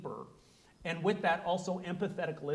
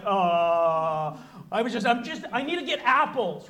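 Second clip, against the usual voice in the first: hammed-up panic, backing away from the overshare; flustered panic; 7 dB louder than usual. Shopper cornered by an oversharer, mock flustered excuse. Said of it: "the person sitting there kinda, like, freaking out".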